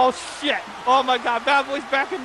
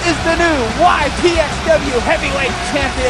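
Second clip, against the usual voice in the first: loud ringside title proclamation; triumphant play-by-play; 3 semitones higher, 7 dB louder than usual. Real, not acted. Commentator proclaims Zane Crow the new champion, triumphant. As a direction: belt out the big moment to the whole arena. Live call of belt presentation.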